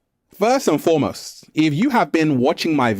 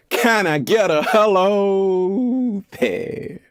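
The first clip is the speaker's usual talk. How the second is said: higher, very slow playful call out; drawn-out call; 6 semitones higher, much slower than usual. Acted. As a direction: stretch it out to the crowd. Calling out to viewers.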